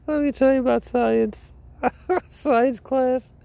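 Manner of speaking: way up high, squeaky and giggly. Pretend. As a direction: squeal it out in a silly falsetto through a laugh. Retells science class story, giggling.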